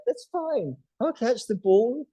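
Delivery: a dog dragging itself but still delighted; cheerful dog voice. Acted. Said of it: "They're still like".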